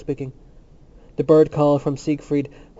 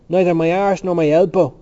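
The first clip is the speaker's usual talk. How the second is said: loud, higher scornful jeer; scornful cry; 4 semitones higher, 8 dB louder than usual. A narrator's reading. Temple mocks the notion of limbo, crying out scornfully. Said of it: "Temple cried out scornfully".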